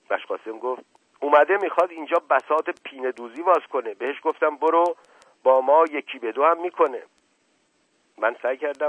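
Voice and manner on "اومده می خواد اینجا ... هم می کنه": loud, higher indignant protest; heated complaint. A narrator's reading. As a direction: justify yourself hotly to the master. Servant protests to his master. (اعتراض بلند و برافروخته)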